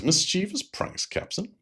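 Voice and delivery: Spock, offended, questions the captain's words; incredulous objection. Retold or imitated. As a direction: repeat the words back in disbelief. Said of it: "Spock objects and says".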